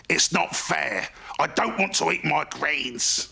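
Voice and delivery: a cross little dragon refusing his greens; sulky young dragon. Acted. Fergal sulks about greens.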